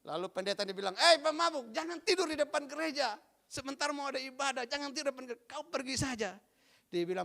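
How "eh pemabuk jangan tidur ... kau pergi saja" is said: high, stern scolding call; scolding pastor. Acted. Pastor telling the drunk to leave before the service, high scolding voice. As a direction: order him off the church steps.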